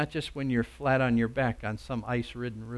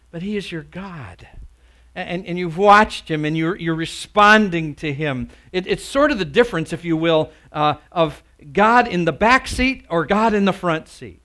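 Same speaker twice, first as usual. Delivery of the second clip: loud, higher pulpit voice, building hard to the last phrase; full-force preaching; 6 semitones higher, 13 dB louder than usual. Real, not acted. Preacher warning of God in the back seat, full-force preaching. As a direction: preach it at full volume, driving each clause.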